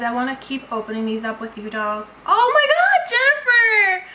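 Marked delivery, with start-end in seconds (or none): 2.3-4.1 loud, high-pitched delighted cry; real thrilled outburst, high and loud; excited squeal. Real, not acted. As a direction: squeal the name in amazed delight. Delighted reaction to opening a package.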